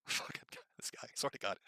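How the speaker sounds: unvoiced, hushed exasperation; a barely voiced swear to God; exasperated whisper. Real, not acted. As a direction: mutter it under your breath, worn out. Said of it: "exasperated mutter".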